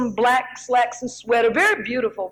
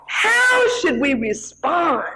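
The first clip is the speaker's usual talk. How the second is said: preaching climax; 4 semitones higher, slower than usual. Real, not acted.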